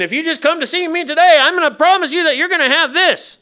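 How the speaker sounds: put-on high, loud, quick Southern TV-preacher accent. Preacher parodying TV promisers luring crowds, hammed-up Southern accent. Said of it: "everybody on T V has a Southern accent".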